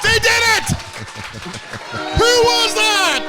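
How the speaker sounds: excited shout. Real, not acted. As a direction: yell at the touchdown. Watching the Lions score.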